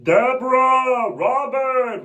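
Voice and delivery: high whining call; loud nasal whine; Ray Romano. Retold or imitated. Husband calling wife and brother, high whine. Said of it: "Ray Romano".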